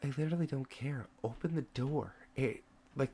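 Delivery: quick, very soft reply; impatient undertone. Acted. Guard tells his colleague to open up, impatient undertone. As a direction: mutter it impatiently under the breath.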